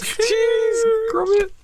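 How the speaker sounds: squeaky high cartoon voice with a laughing cry; hammed-up falsetto Cheese Gromit; a goofy high character bit, mock crying; silly high voice. Silly voice after Blair bit.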